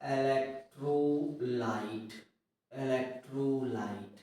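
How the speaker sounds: very slow word by word, long pauses; low, drawn-out dictation in separate chunks. Real, not acted. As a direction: dictate for students writing it down. Teacher dictating a sentence for notes.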